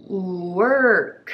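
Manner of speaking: drawn-out work with final k; three sounds blended. Shown as a demonstration. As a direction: work stretched slowly as oo-er-k. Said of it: "workを三つの発音に".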